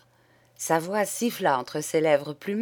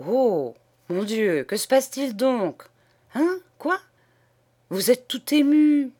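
startled, rising higher to a louder question; alarmed surprise; 7 semitones higher, 4 dB louder than usual. Acted. Priest reacting to the upset nun, startled and anxious. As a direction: caught off guard and worried.

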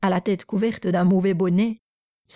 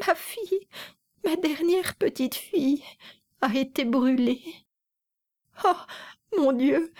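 high, wailing grief-stricken mother; keen over the lost child; mother wailing to a stranger; tearful lament; 7 semitones higher, 4 dB quieter than usual. A narrator's reading. Mother lamenting her burned daughter.

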